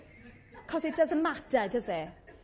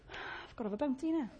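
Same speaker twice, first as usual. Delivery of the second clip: sighing, breathy put-on complaint; mock despairing moan; 9 dB quieter than usual. Preacher re-enacts moaning over a broken vending machine, mock despair. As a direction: sigh it out as if hard done by.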